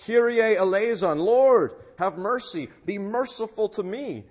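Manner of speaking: loud, high cry for mercy; fervent shouted prayer; shouted plea. Acted. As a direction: call out boldly to the Lord. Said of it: "we should shout".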